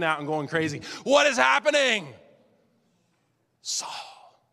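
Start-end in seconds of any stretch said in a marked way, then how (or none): 1.0-2.1 high, loud panicked cry; panicked outcry. Retold or imitated. Saul freaking out. 3.6-4.4 soft, breathy whisper; whispered divine voice. Acted. Heavenly voice whispered.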